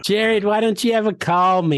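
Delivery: a hint of a nagging grandmother; lightly voiced old woman. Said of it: "you're the good woman voice guy".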